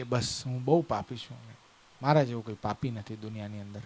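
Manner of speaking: low, soft, downcast admission; acted remorse, voice dropped and subdued; tearful confession. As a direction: confess quietly, weighed down by guilt. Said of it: "રડવાનું શરૂ કર્યું".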